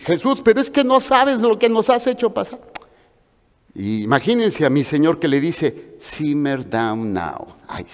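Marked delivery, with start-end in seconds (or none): 0.0-2.6 high, exasperated mother's scold; mother scolding her son found in the temple; worried mother scolding. Retold or imitated. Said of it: "Y todavía le dicen". 6.0-7.5 low, slow soothing English; slow it down to calm her; calm English line. Retold or imitated. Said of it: "hablando en inglés".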